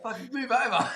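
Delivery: high, laughing jab; laughing mock complaint. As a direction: tease him through a laugh. Joking to the stranger.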